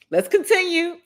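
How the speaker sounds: pushing through tears; trying to move on while welling up; choked up. Real, not acted. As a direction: voice cracking high with tears. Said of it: "I'm trying not to get emotional".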